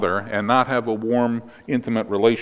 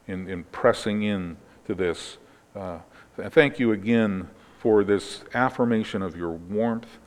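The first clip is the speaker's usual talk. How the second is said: soft, lower-pitched spoken prayer; hushed closing prayer, settling lower; 3 semitones lower, 4 dB quieter than usual. Real, not acted. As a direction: pray quietly and earnestly, addressing God. Leads a prayer.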